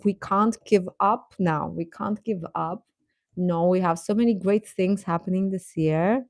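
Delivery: ordinary talk, nothing marked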